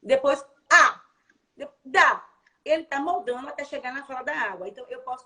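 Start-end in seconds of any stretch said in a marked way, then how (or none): 0.7-1.0 loud, higher grunted "ah" asking for something; child's grunt. Shown as a demonstration. Therapist imitating a child's first attempt, loud grunt. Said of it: "primeiro ele fez assim". 1.6-2.2 put-on toddler-like loud "dá"; child's first word. Shown as a demonstration. Therapist imitating a child's next step, blurted "dá". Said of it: "primeiro ele fez assim".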